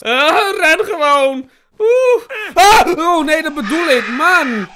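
loud, high yells under attack; panicked shouting. Real, not acted. Player fleeing the monster, panicked shouting. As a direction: scream and protest in a real panic.

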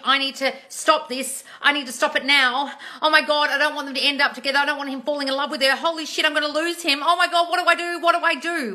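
high, loud spiralling panic; hammed-up frantic worrying, building in pitch to the end; mock panic. Coach acts out a viewer's thoughts about the ex, panicked. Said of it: "you're in a state of panic".

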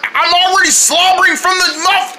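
ordinary delivery

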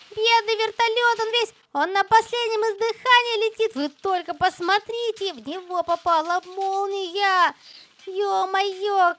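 ordinary talk

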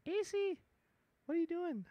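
very high soft pet-talk voice; cooing at cat. Real, not acted. Streamer cooing at his just-woken cat, high and soft. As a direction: coo up high like talking to a kitty.

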